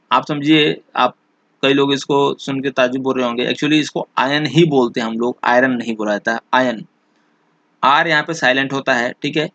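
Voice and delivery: ordinary delivery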